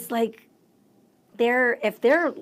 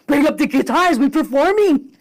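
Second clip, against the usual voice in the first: loud, raised imitation of phone orders; urgent calling out; 4 semitones higher, 8 dB louder than usual. Musician phoning his band to bring guitars, urgent call.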